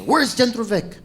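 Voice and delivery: mild mock desperate yell; acted frantic demand. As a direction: press urgently for directions. Repeats desperate demand for directions.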